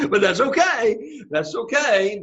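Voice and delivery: high, drawn-out words through laughter; laughing reassurance. Real, not acted. Teacher to puzzled class, laughing it off. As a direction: reassure them while still laughing.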